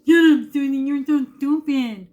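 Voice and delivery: slow, high, loud drawn-out scorn; imitating sister talking down to her; mocking older sister. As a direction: drag it out like a scornful big sister. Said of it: "That's how she talked to me".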